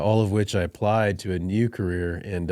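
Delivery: ordinary delivery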